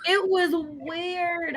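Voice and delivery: very slow, stretched-out weird; slow drawn-out. Real, not acted. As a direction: drag every word out.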